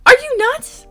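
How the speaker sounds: loud, very high-pitched rant; outraged screech. Retold or imitated. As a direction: shriek it in sheer disbelief. Outraged at the extra price.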